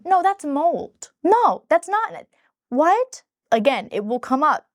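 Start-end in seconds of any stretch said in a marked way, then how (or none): 0.0-3.2 incredulous retort. Retold or imitated. Her retorts to ex's shower claim.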